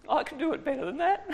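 quick, high smug aside; cocky thought. Retold or imitated. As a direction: toss it off with a laugh in your voice. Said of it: "I was thinking".

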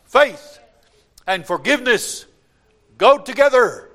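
high, loud proclamation; full-force preaching. Real, not acted. Preacher declaring forgiveness to the congregation, full force. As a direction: ring it out to the congregation.

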